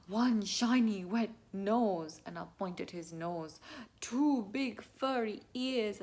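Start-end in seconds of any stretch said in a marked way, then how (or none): 0.1-2.2 slow, loud, wondering read; deliberate, building suspense; suspenseful storybook reveal. Shown as a demonstration. 4.0-6.0 slow, loud, wondering read; deliberate, building suspense; suspenseful storybook reveal. Shown as a demonstration.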